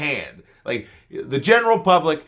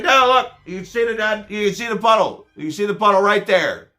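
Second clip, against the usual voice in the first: exasperated pointing; 7 semitones higher, 4 dB louder than usual. Retold or imitated. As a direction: spell it out to clueless passersby.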